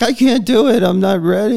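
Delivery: high, panicky retelling of backing out; mock freak out. Host admits nearly bailing on the show, mock panic. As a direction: squeak it out like a nervous wreck. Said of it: "Well, not a freak out".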